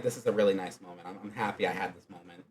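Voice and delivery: tender. Retold or imitated. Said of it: "and so Jinzo's just like".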